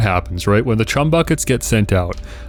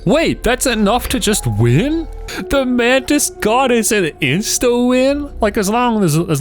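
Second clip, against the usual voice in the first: astonished; 13 semitones higher than usual. Real, not acted. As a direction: squeak out the shock. Discovers card wins instantly.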